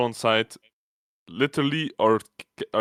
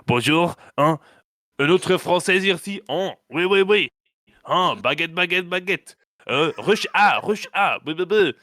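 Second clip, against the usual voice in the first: hammed-up French accent, higher and louder; mock French accent; 7 semitones higher, 4 dB louder than usual. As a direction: overdo a cheesy French accent like a gamer cliché. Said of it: "the most vile French accent ever".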